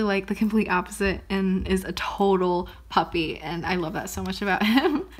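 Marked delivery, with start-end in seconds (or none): none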